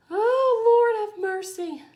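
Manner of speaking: high, drawn-out cry that eases off; high-pitched burst of being overcome; overwhelmed exclamation. Real, not acted. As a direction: wail it gratefully, loud at first.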